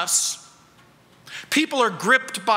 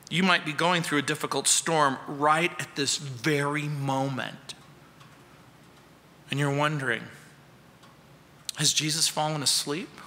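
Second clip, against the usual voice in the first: hushed; 7 semitones lower, 5 dB quieter than usual. Acted. Preacher drops to intimate tone.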